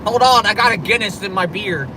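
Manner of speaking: normal talking voice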